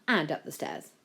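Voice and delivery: plain reading voice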